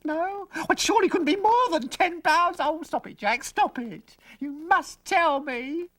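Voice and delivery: very high, breathless, giddy mother; excited mother. Acted. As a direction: squeal like an eager mother guessing.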